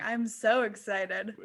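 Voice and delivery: normal talking voice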